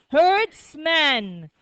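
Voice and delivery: "herdsman" slowly in two clear parts; model pronunciation. Shown as a demonstration. Teacher has class say the new word, model pronunciation. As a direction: say "herdsman" drawn out for repeating. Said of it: "Say it".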